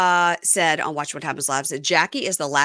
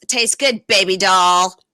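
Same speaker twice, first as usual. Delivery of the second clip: louder, punched-up mock taunt; acted taunt; 7 dB louder, slower than usual. Retelling Jen taunting Danielle, mock taunt. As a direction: throw it out as a gloating jab.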